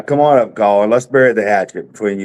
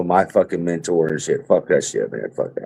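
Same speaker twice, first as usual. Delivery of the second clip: ordinary talk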